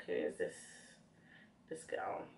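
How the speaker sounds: a low, breathy, sneering mutter; lightly voiced judging flatmate; disdainful roommate. Imitating roommate Blessing judging her at uni, low and sneering. Voicing friend's disdainful look.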